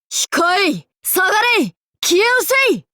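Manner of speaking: imperious demon-king commands building to a roar; commanding shouts, building. Acted. Battle commands rising.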